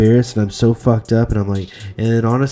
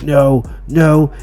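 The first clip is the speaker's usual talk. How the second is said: higher, louder panicked no no; retold rising panic as it starts to drip; 5 semitones higher, 6 dB louder than usual. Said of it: "And then I was like".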